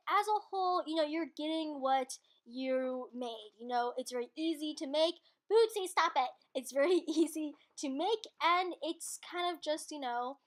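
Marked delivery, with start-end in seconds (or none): 5.5-6.3 a sharp, higher call to the dog. Real, not acted. Scolding the dog mid-recording, a sharp call. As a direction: snap the dog's name to stop it.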